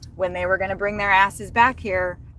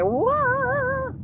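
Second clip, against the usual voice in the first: a sudden, much higher, long drawn-out whoa; amazed whoa. Retold or imitated. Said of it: "but I was like".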